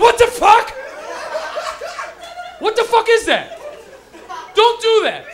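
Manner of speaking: loud, high-pitched shocked friend; mock alarmed shout. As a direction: act out a friend yelling in disbelief. Friend shocked by the baby cry.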